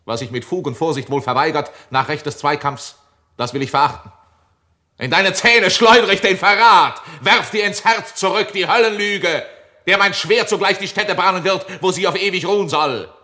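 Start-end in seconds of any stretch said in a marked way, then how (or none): 5.0-13.1 high, loud defiant retort; booming echoing villain monologue. Acted. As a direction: fling the lie back at him. Edmund hurls back the challenge.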